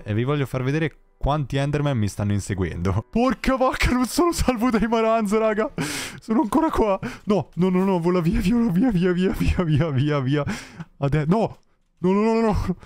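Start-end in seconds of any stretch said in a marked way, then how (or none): 3.1-12.8 high panicked pleading, fleeing; frantic yelps while running away; being chased. Real, not acted. Fleeing Enderman swarm in game. (in fuga dagli Enderman, voce acuta e in panico)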